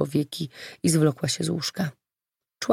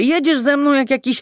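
high, loud scolding; upset shouting; 9 semitones higher, 5 dB louder than usual. A narrator's reading. Olga scolds the driver who frightened her, upset shouting. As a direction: keep scolding in a raised voice. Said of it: "krzyknęła zdenerwowana".

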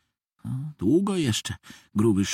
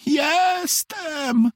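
high, drawn-out cry to be noticed; squeaky high call from the toy bear; yelling out; 13 semitones higher than usual. A narrator's reading. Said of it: "wrzasnąłem jak mogłem najgłośniej".

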